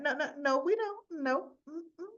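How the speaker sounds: high-pitched, acted-out refusal; hasty objection. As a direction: shush him with quick high noes. Laura stops Valentine going to court.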